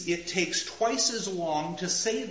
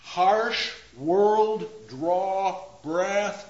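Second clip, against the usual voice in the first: very slow, louder, each word dragged out; slow and heavy; 5 semitones higher, 5 dB louder, much slower than usual. Shown as a demonstration. Teacher dwelling on long vowels in Harsh world draw breath. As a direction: lean hard on every long vowel. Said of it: "Notice what happens as well with the sounds".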